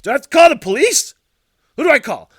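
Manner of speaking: loud, high outraged questions; worked-up, shouted frustration; exasperated shout. Retold or imitated. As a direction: demand it at the top of your voice. Acting out his frustration.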